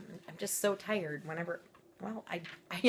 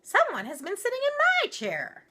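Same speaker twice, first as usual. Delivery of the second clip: much higher, louder fussy mama bear; middle-sized voice; 8 dB louder than usual. Acted. Mama Bear discovering her chair was used, high and fussing. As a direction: fluster like a shocked mother bear. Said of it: "in her middle-sized voice".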